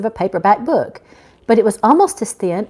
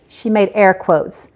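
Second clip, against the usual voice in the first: ordinary delivery, nothing marked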